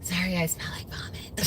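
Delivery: breathy whispered rasp; husky whisper. Real, not acted. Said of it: "In her sexy voice".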